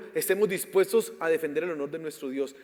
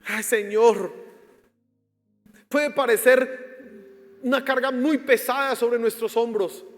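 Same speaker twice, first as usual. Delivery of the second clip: loud, higher, impassioned prayer; fervent prayer; 5 semitones higher, 9 dB louder than usual. Real, not acted. Pastor praying over the congregation, loud and fervent. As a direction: cry out to God with all your force.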